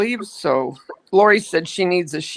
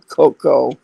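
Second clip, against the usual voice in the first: ordinary talk, nothing marked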